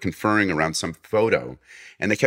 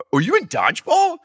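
suddenly much higher and louder, thrilled; starstruck cop; 6 dB louder than usual. Retold or imitated. Cop recognizing the actor he cuffed, excited fan squeal. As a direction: blurt it out like a fan spotting a star.

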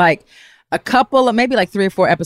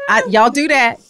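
ordinary talk

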